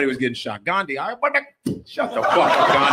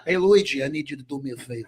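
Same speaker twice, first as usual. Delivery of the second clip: mob boss; 2 semitones higher, faster than usual. Pretend. Voicing mob boss skit.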